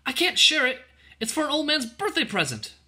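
put-on very high girlish pleading; crying out. A narrator's reading. Ellen refusing the soldier her bread, high urgent plea. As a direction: plead loudly in a child's voice. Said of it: "she cried as she clung".

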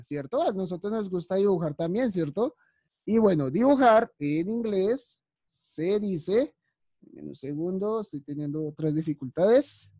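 ordinary talk

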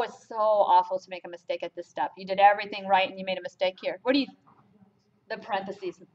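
ordinary talk, nothing marked

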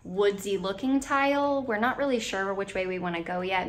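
ordinary delivery

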